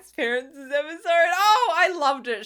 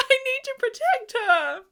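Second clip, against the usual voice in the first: protective coo; 12 semitones higher than usual. Retold or imitated. Fan still cooing over Sutton.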